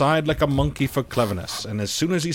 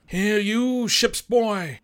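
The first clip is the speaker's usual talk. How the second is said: higher, slow barked call; captain crying out; 9 semitones higher, much slower than usual. A narrator's reading. Captain shouts at the boy by the swivel, barked call. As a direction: call the boy away sharply. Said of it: "he cried".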